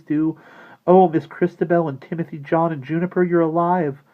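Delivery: put-on high, loud, earnest girl's voice; emotional outburst. A narrator's reading. Maggie bids the living dolls goodbye, high overjoyed outburst. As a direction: pour it out overwhelmed and glad.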